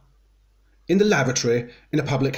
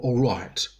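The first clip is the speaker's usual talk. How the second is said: usual speaking voice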